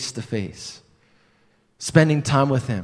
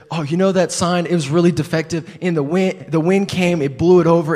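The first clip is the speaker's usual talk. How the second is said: breezy fake excuse; 5 semitones higher, 4 dB louder than usual. Lying to the youth pastor.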